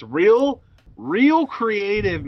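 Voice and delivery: drawn-out sarcastic praise. Acted. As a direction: drip with sarcasm, slowly. Sarcastic jab at Cameron.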